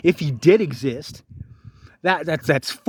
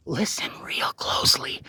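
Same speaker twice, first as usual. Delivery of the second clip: whispered, much softer; 2 semitones lower, 7 dB quieter than usual. Acted. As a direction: lean in and breathe the words. Whispered aside to viewers.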